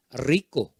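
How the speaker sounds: "rico" low, with a rolled R; proper "rico", low-pitched rolled R; correct pronunciation. Shown as a demonstration. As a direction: model "rico" carefully, trilling the R. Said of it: "hay que hacer bien su pronunciación".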